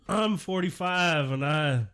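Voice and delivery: mock a man proud of his big thoughts; Elon Musk. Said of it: "Are you familiar with Elon Musk?".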